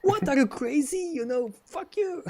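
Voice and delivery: high-pitched, incredulous outburst; acted indignation. Customer reacting to Google's threat to charge him, indignant.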